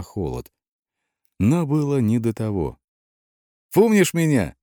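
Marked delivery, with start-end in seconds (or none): 3.7-4.5 high, eager, insistent question; mildly pushy, raised voice; persistent. A narrator's reading. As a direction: press for an answer, pitched high. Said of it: "не отставала я".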